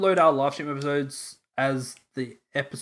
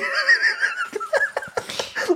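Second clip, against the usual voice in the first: laughter. Real, not acted. Laughing at own ad idea.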